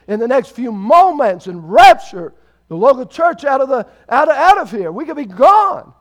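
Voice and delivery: loud, high, urgent pulpit proclamation; full-force preaching. Real, not acted. Preacher warning that Jesus could come today, full-force preaching. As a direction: proclaim it to the whole church with rising force.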